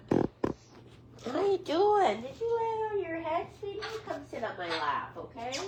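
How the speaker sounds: high, soft cooing; tender pet comforting. Real, not acted. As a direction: coo softly to a hurt little pet.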